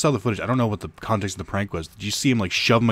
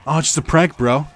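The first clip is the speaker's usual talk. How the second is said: higher, louder smug brush-off; dismissive mocking parent; 6 semitones higher, 6 dB louder than usual. Parent brushing off the sobbing kid, smug mock. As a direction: toss it off like it's all a joke.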